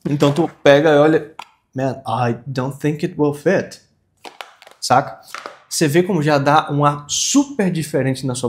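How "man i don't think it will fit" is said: slow "think it will fit" as "thenk et wel fet"; slow linked model, every i in "think it will fit" toward ê; corrected pronunciation. Shown as a demonstration. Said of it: "o I tem som de E".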